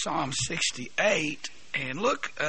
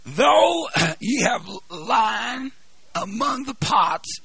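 slow, loud, drawn-out preaching; solemn scripture reading; 4 semitones higher, 3 dB louder, slower than usual. Acted. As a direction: proclaim every phrase slowly. Reads scripture in preaching cadence.

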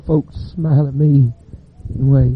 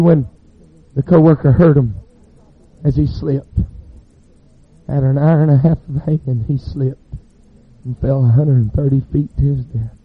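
usual speaking voice

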